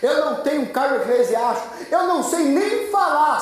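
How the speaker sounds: high-pitched, insistent excuses; acted pleading protest; protesting Moses. Moses listing excuses to God.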